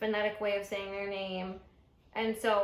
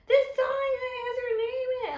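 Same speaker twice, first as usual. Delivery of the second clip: put-on drunk friends shouting; 14 semitones higher, 5 dB louder than usual. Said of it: "they're saying".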